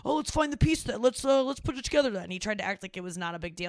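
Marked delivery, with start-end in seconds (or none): 0.1-2.3 breezy dad voice. Retold or imitated. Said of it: "he tried to just go like".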